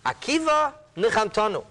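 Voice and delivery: high, emphatic declaration; heartfelt exclamation. Retold or imitated. Sages tell Akiva he comforted them, emphatic. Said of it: "in this exact language they responded".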